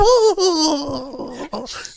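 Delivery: high, loud haughty noble cackle; snooty high laugh. Acted. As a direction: laugh down at the poor girl.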